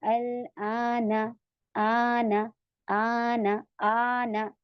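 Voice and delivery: vowel held long and slow; six-count elongation. Shown as a demonstration. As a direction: stretch it over six counts. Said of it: "तो इसमें हम छह count ही खींचेंगे". (छह count तक खींचकर)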